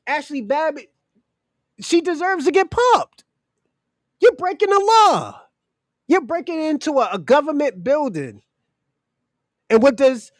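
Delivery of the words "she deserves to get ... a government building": high-pitched, heated insistence; indignant. Real, not acted. Condemning a rioter for breaking the law, indignant. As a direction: hammer it home in raised pitch.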